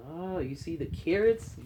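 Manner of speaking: ordinary delivery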